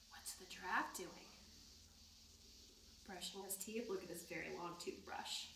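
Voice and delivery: very soft bedtime voice; soft hush. Real, not acted. Winding down softly.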